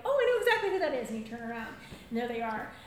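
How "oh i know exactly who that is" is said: quick, high, loud realisation; delighted recognition. Retold or imitated. Hearing a familiar voice in supermarket, quick excitement. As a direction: rush it out, recognising the voice. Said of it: "and you're like".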